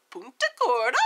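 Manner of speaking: Punta Gorda in a playful put-on accent. Shown as a demonstration. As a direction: say the place name with a relished, lilting accent. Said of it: "that has an accent coming out of my mouth".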